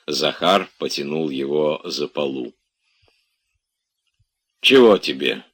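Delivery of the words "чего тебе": loud, stern demand; menacing. A narrator's reading. Woken master to his bothersome servant, menacing. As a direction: snap at him with some menace. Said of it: "грозно спросил Обломов".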